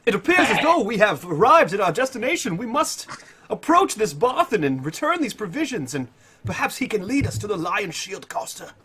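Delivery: grandly proclaimed, high-pitched character voice; Tiberius. Acted. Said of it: "it's Tiberia's voice".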